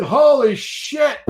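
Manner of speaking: high, loud, drawn-out exclamation; comically startled outburst; shocked. Retold or imitated. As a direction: stretch it out in retold alarm. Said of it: "and I said".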